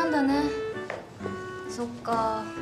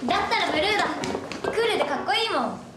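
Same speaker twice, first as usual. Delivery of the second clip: excited; 5 semitones higher, 5 dB louder, faster than usual. Acted. Girl eagerly picks blue instead.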